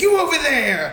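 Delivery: high-pitched mock principal breaking up a fight; principal intervening. Principal breaking up a students' fight, hammed-up high voice. Principal's order acted again.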